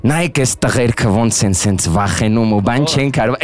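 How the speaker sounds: mock creepy conjuring voice; fake Armenian incantation. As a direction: chant invented Armenian like a scary ritual. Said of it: "lo decía con tono de miedo".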